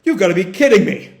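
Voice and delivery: mock stunned exclamation. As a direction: react incredulously to bad news. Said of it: "just sort of stunned there".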